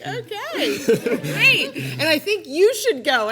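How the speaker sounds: laughing okay. Retold or imitated. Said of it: "I was like".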